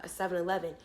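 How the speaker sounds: ordinary talk, nothing marked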